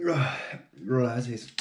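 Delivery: ordinary delivery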